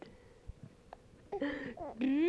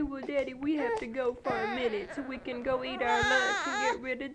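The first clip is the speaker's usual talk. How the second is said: voicing the baby; 8 dB louder than usual.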